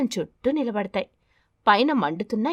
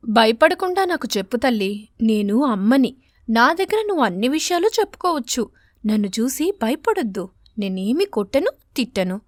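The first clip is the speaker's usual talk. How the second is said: put-on louder, tender mother coaxing her child; 2 semitones higher, 4 dB louder than usual. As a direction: gently urge the frightened girl to speak. Mother coaxing scared daughter. (కూతుర్ని బుజ్జగిస్తూ అడిగే అమ్మ గొంతు)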